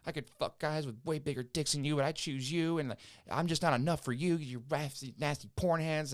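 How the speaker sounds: hammed-up drunk girlfriend tirade; mock drunk girlfriend rant. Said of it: "screaming at me in the hallway".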